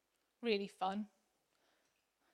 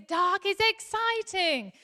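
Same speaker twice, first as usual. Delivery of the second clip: put-on high, slow, excited little boy; a small boy thrilled by fireworks; 7 semitones higher, slower than usual. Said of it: "the little boy says".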